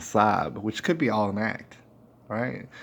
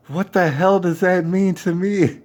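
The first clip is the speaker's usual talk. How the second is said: louder, higher, slow disbelief; incredulous retort; 7 semitones higher, 5 dB louder than usual. Retold or imitated. To stranger who gave her name, incredulous. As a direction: throw it back at her, incredulous.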